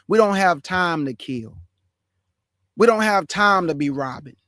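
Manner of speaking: ordinary delivery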